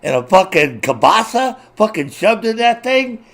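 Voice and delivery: high, loud gawking exclamation; mock amazed reaction. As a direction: act stunned by the size of it. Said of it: "You'd be like".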